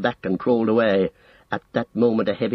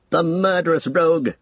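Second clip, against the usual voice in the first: higher-pitched outraged exclamation; indignant cry; 5 semitones higher than usual. A narrator's reading. Eomer reacting to the thrown crystal globe, indignant cry. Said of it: "cried Eomer".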